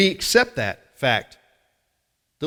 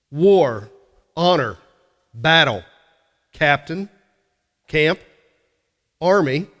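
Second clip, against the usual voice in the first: each word punched out louder, one by one; preaching roll call of battle words; emphatic word list; 4 dB louder, slower than usual. Acted. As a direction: hammer every word like a drumbeat. Preacher chants a list of war words.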